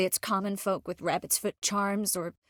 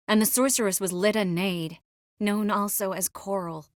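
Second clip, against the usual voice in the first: normal talking voice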